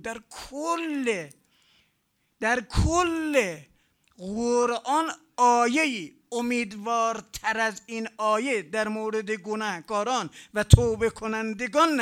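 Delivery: loud, high, drawn-out proclamation; emphatic preaching. Real, not acted. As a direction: preach it at full force, hammering each phrase. Preaching at full force. (موعظهٔ پرشور با صدای بلند)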